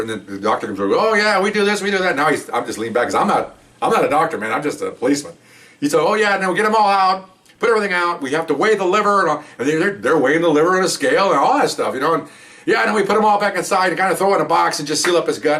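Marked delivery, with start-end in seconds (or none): 0.9-2.1 higher-pitched, offhand doctor quote; breezy doctor. Retold or imitated. Doctor explaining autopsy steps to recruit, breezy. 6.0-9.2 high-pitched, matter-of-course rundown; breezy doctor. Retold or imitated. Doctor telling recruit about the organs, breezy. 12.7-15.6 higher-pitched, casual rundown; breezy doctor. Retold or imitated. Doctor explaining how the body is closed up, breezy.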